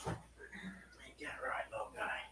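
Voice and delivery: slow, drawn-out whisper to the pet. Real, not acted. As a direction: whisper tenderly to the little guy. Talking softly to the pet.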